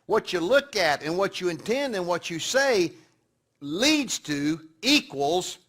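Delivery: loud, slow, emphatic sermon climax; preaching at full force. Acted. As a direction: drive each word home to the congregation. Preaches the summary.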